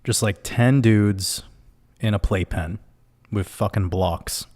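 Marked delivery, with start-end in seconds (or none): none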